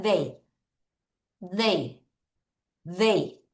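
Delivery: "They" low and soft, tongue between teeth; th pronunciation demo. Shown as a demonstration. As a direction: model "They" for repeating. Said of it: "T H 발음할게요". (혀를 이 사이에 두고 "They")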